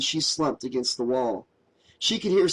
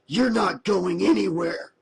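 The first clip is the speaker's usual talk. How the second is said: growled; 4 semitones higher than usual. A narrator's reading.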